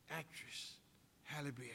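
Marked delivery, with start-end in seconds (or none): none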